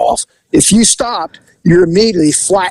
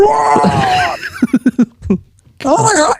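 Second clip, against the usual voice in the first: high mock shriek of disgust; retold outcry; 12 semitones higher, much slower than usual. Retold passenger cries out in horror as she vomits again. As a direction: cry out much higher in retold horror.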